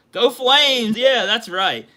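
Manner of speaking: high, loud fan cheer; rally for your team. Real, not acted. Cheering his team's gear, loud high fan cheer.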